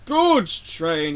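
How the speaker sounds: proud engine grumbling slowly at the insult. Acted. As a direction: drag out goods train in disgust. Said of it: "he grumbled".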